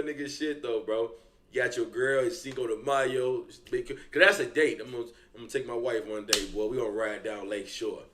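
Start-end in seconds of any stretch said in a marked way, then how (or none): none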